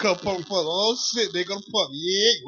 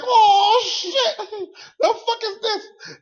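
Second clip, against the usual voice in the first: very high, drawn-out wail; mock horrified dismay; 13 semitones higher, 7 dB louder, much slower than usual. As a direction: wail it slowly in comic panic. Reliving his dismay.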